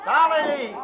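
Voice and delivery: loud high call across the room; calling out her name from a distance. Acted. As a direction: shout her name to fetch her.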